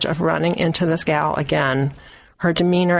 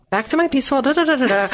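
high, light and playful; mock blissful; 9 semitones higher, much faster than usual. Storyteller back in her happy place, playfully mock blissful.

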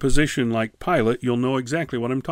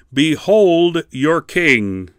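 slow, loud commanding proclamation; 3 semitones higher, 6 dB louder, much slower than usual. Retold or imitated. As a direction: proclaim it slowly, like an order. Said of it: "He did it with a loud voice".

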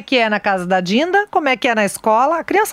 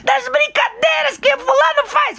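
put-on very high shouted child answer; a son correcting mom in a shout; 14 semitones higher than usual. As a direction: belt it out like a relieved little boy.

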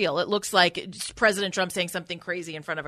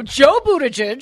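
slow, louder stress on "Joe"; emphatic Joe Buttigieg; 5 semitones higher, 6 dB louder than usual. Shown as a demonstration. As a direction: punch "Joe" in "Joe Buttigieg" with a rising tune. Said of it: "It's the same thing".

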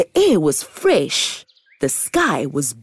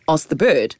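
ordinary delivery

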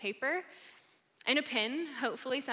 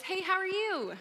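bright, higher passing greeting; breezy hallway hello; 8 semitones higher than usual. Retold or imitated. Greeting someone passing in the hall, breezy and higher. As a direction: toss off a polite hello in passing.

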